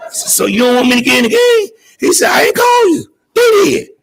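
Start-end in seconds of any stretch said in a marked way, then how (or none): 0.1-1.7 high, pleading disbelief; heartbroken, asking to be sure; disbelieving question. Retold or imitated. Realizing he won't play. 2.3-3.9 high, loud shout; coach yelling. Retold or imitated. Coach snapping back at him.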